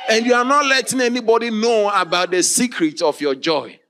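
loud, high preaching cry; preaching shout. Real, not acted. Preacher shouting at his church about joy. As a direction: shout it out to the congregation.

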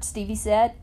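ordinary delivery, nothing marked

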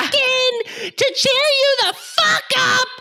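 very high, loud shrieking yell; mock screaming. Friend imitating Hermione screaming at Hagrid's door, high shriek. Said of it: "screaming at the door".

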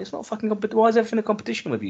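high-pitched, hurried mock exasperation; exasperated retort. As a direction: act out snapping at a show-off. Acted retort to a one-upper.

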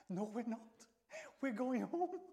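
hammed-up nervous refusal; nervous retreat. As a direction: back away hastily, playing it scared. Acts out frightened reply to heckler.

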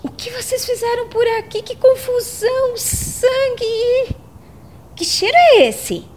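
shocked. Acted. Shocked arrival at bloody scene.

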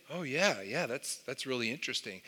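lightly voiced shrug of a reply; mock bored friend. Friend humoring him, mild soft indifference.

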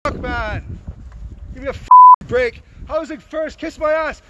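real exasperated yell; exasperated shout. Real, not acted. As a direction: drag it out in disgust. Angry protester confronting filmmakers.

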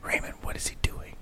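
urgent whispered question. Retold or imitated. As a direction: hiss it so nobody else hears. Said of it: "she goes".

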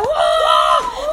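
high, loud laughing wow; hammed-up wow; mock amazement. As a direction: gasp in amazement. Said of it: "vocês têm que ficar".

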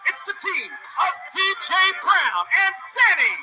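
drawn-out booming ring announcement; ring announcer. Acted. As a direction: stretch the names like a ring announcer.

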